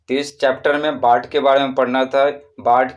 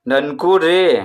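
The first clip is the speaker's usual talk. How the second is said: calling Nanku रे with रे stretched; long-held रे; 3 semitones higher, 4 dB louder, slower than usual. Shown as a demonstration. Teacher shows how Nanku is called, drawn out. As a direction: hold रे long, as in a call. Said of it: "रे ज़्यादा समय तक उच्चारण करेंगे".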